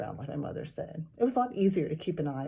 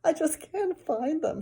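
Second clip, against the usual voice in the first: put-on whiny girl; 10 semitones higher, 3 dB louder than usual. A narrator's reading. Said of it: "Sheila said".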